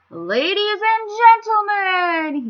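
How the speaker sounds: slow, high and loud grand king; royal announcement. Acted. King opens the ceremony to the crowd, grandly slow and high. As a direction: proclaim it from the platform. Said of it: "he said to the crowd".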